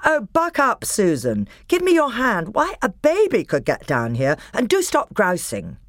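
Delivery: put-on older brother calling down, impatient; Peter, impatient. A narrator's reading. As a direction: chivvy his sister along. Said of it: "Peter's voice saying".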